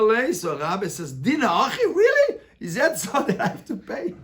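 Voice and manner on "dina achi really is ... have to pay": high disbelieving "really?" with a laugh; mock disbelief, rising high; incredulous. Rabbi's incredulous reply in story.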